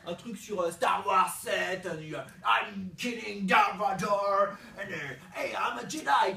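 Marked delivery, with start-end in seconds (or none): 1.8-6.3 loud mock English-accented action hero; hammed-up English accent. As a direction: play a Jedi in English. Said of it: "il parle avec l'accent anglais".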